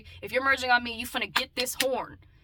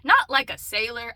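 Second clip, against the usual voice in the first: ordinary talk, nothing marked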